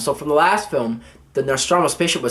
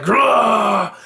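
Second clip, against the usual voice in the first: growl; 5 semitones higher than usual. Retold or imitated. Marines posing with rifles, a mock growl. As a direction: snarl like a tough marine.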